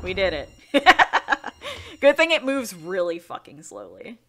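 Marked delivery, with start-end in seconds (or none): 0.7-1.9 loud, high laughter; relieved laugh. Real, not acted. Player just completed the mission, loud high laugh. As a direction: burst out laughing.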